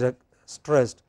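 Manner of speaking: ordinary talk, nothing marked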